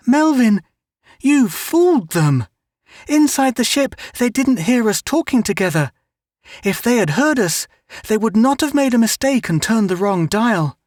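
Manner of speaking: put-on high, earnest little girl; Mary Ann talking to her brother Melvin, high girlish voice; young girl voice. A narrator's reading. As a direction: voice the sister, bright and eager.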